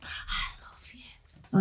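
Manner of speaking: soft, slow, breathy I love you; imitating a man's slow, soft whisper while taking her money; mock whisper. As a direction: whisper it like a creepy charmer. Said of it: "he was like".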